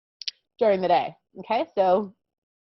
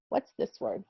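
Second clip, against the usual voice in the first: whispered What's this word; a playful secret whisper to the class; 11 dB quieter than usual. Shown as a demonstration. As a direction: ask it again in a whisper. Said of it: "asks twice, second whispered".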